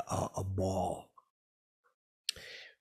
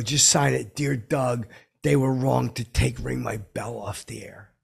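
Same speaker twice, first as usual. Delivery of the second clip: loud, pushy demand; 2 semitones higher, 6 dB louder than usual. Acted. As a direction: bark orders at him.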